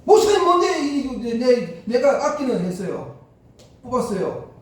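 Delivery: imitated angry scream, full volume; retold furious yell. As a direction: yell it as an outraged old deacon. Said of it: "막 꽝을 지르고".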